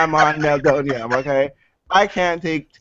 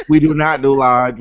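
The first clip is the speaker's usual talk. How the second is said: ordinary delivery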